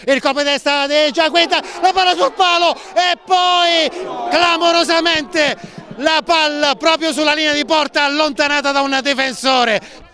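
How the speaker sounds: loud, high shout at the post; excited commentary. Real, not acted. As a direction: shout the near miss. Shot hits the post.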